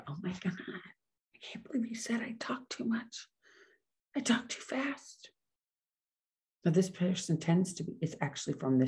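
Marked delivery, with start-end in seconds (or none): 0.1-5.3 high, hushed, pretend-offended gasp of words; mock hurt whisper. Podcaster pretending to take a listener's remark as an insult, mock hurt whisper.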